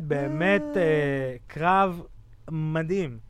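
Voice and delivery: ordinary delivery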